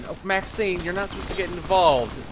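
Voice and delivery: ordinary talk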